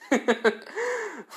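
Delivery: high-pitched, soft acted laugh. Issei after burning the Stray Devil, high acted laugh.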